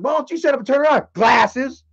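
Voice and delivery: high, quick jeer with punched last word; mocking retort. Heckler to girl with glasses, mocking retort.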